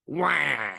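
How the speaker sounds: loud high cartoon Wah yell; Wario-style wah. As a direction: bark a cartoon villain wah. Waluigi-style cry.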